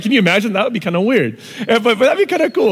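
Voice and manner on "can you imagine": ordinary delivery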